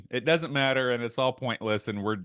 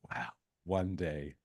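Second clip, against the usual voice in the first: dreamy wish; 9 semitones lower, 10 dB quieter, slower than usual. Retold or imitated. Kid's dreamy wish, retold.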